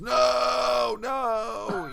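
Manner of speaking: high, loud anguished scream of no. Retold or imitated. Retelling Loomis's final moment, high loud scream. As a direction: throw the no up high in despair. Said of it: "as he screams".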